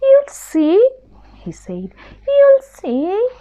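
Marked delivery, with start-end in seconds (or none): 0.0-0.9 much higher, small squeaky mouse; a tiny scared mouse begging pardon; little mouse voice. Acted. Mouse insists. 2.3-3.3 much higher, small squeaky mouse; a tiny scared mouse begging pardon; little mouse voice. Acted. Mouse insists.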